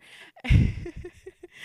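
sudden high, loud burst of laughter; amused laugh. Real, not acted. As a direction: crack up at your own slip. Laughs at her own mix-up.

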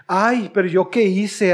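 slow, mock-innocent whine; sarcastic innocence. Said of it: "forma sarcástica". (inocencia sarcástica y lenta)

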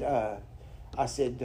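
usual speaking voice

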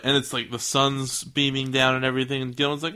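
ordinary talk

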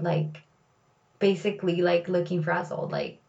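usual speaking voice

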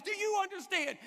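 high, exasperated demand; mock drill-sergeant challenge; fed-up sergeant. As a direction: imitate a sergeant losing patience. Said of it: "gets kind of fed up with him".

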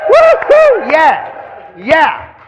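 high, loud whoop of joy; woo-hoo cheer. Shown as a demonstration. Teacher cheering a failure, a loud high whoop. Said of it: "what do you say about".

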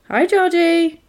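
high-pitched sing-out clown greeting; Pennywise. Retold or imitated. Clown in the drain greets a child, high mock voice. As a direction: call out high, imitating a creepy clown. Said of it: "I'm Pennywise".

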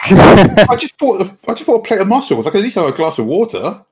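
laughing protest. Acted. Player complains about the mussels.

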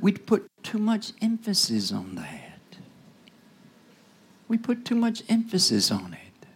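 hushed whisper, slowing down; a preacher's hushed rebuke; whispered warning. Acted. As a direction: lean in and whisper the point.

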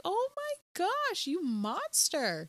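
very high, drawn-out scandalized gasp of words; mock horror. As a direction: slowly scold with hammed-up shock. Acts out horrified reaction to film.

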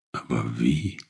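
a small, hesitant question from the wolf cub; timid. Acted. Wolf cub asks the sheep how to free it, timid. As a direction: ask it shyly, unsure of yourself. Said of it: "erwiderte der Kleine zaghaft".

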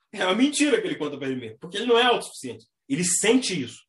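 ordinary delivery

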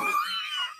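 high, squeaky laugh; teary laugh. Real, not acted. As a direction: laugh that half cracks into crying. Laughing at her own tears.

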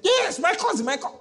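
high, loud excited answer; eager mock reply. Church member answering the prophet, high and excited.

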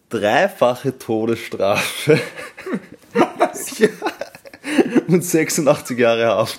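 loud, slow grand finale reveal; hyped giveaway announcement. Pretend. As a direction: unveil the winner with big drama. Grand finale of mock results.

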